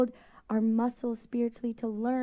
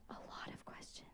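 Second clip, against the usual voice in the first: whisper; 26 dB quieter than usual. Acted. Aside about kids questioning.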